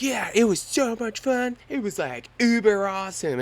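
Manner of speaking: high, loud hyped-up dude; imitating the instructor. Instructor hyping up the squirrel suit, high and loud. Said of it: "The guy's like".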